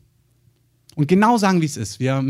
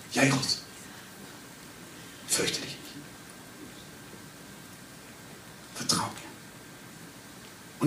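whispered, softer and very slow at the end; softly spoken; 3 semitones lower, 17 dB quieter, much slower than usual. Retold or imitated. The Lord comforting Jairus, soft whisper. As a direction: breathe it as a gentle reassurance. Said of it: "dass er das so leise sagt".

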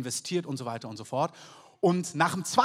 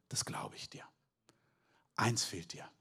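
whispered Jesus voice; 9 semitones lower, 8 dB quieter than usual. Acted. Jesus speaking gently to young man.

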